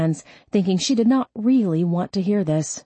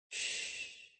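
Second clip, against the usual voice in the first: very soft, voiceless shushing; soft hush; 23 dB quieter than usual. A narrator's reading. Mother comforting her tearful daughter, soft hush. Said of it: "Kathy hushed".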